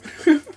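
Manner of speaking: quick, high burst of laughter; short loud laugh. Real, not acted. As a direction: burst out laughing briefly at yourself.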